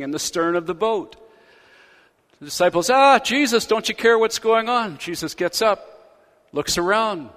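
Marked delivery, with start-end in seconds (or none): 2.9-5.0 panicked cry. Retold or imitated. Panicked disciples in the storm.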